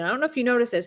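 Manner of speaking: ordinary talk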